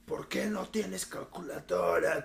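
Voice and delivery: slow mock Austrian-accented teacher asking "¿Por qué no tienes calculadora?"; slow imitation of a stern teacher in a heavy Austrian accent; Arnold Schwarzenegger. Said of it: "Como Arnold Schwarzenegger".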